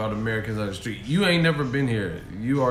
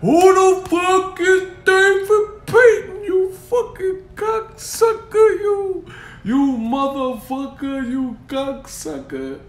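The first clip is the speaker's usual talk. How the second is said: very high, slow mock screaming; acted furious outburst; 8 dB louder, much slower than usual. Imitating Americans going off on the asker, high screamed rant. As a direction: shriek it at full force, dragging every word. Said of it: "if I ask them in America".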